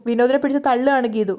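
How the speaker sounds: ordinary delivery, nothing marked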